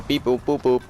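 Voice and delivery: playful beep boop computer noises; mouthed keypad beeps. Retold or imitated. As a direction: chirp it like a little machine. Explaining computer processing noises.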